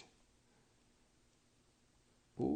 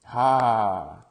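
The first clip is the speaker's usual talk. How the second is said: loud triumphant "ha"; triumphant shout; 2 semitones higher, 16 dB louder than usual. Real, not acted. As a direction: bark a victory laugh. Gets the three star pull.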